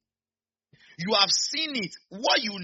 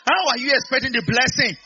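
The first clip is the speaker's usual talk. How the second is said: much louder and higher, demanding a response; rousing shout; 8 semitones higher, 10 dB louder than usual. Real, not acted. Preacher challenges an unsure-sounding congregation, rousing shout. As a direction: shout it to rouse the crowd. Sounding like you are not sure.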